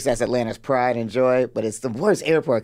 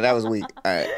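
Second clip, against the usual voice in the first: usual speaking voice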